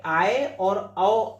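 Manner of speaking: आए और औ stretched very slowly; vowels slowly drawn out. Shown as a demonstration. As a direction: sound out आए and औ for students. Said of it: "पहला क्या था कि ऐ". (आए और औ को बहुत धीरे खींचकर बोलें)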